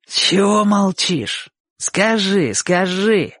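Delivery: high, drawn-out taunt; mocking, urging. A narrator's reading. Bocho hurries silent Chik for an answer, mockingly. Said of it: "насмешливо торопил его Бочо".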